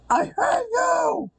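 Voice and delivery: very high, drawn-out screech; hammed-up rage scream. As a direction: scream it slowly in over-the-top rage. Quote escalates into a scream.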